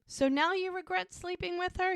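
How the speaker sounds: very high-pitched acted disbelief; incredulous challenge. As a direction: voice a girl challenging her ex. Girl challenges cheating ex, acted.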